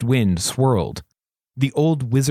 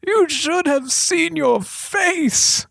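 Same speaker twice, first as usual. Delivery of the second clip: wheezed out; much slower than usual. A narrator's reading. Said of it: "managed to wheeze out".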